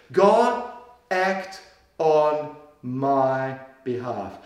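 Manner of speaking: "act on my behalf" drawn out very slowly and low; slow, weighty plea. Shown as a demonstration. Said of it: "he uses this word".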